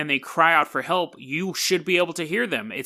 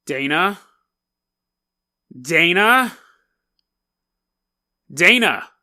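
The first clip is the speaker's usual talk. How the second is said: retold calls, building louder; calling out; 7 dB louder, slower than usual. Guest calls up to sleeping friend upstairs, calling out. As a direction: call for a friend, each try more forceful. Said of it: "being much louder than I am on the podcast".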